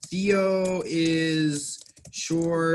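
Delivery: very slow and clear; teacher slowing right down to pronounce the name; slow, careful naming. Shown as a demonstration. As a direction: draw out each word for the learner. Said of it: "Theo is the name in English".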